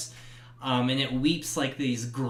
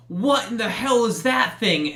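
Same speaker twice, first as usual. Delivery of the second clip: startled sheriff; 8 semitones higher, 7 dB louder than usual. Acted. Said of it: "and he goes".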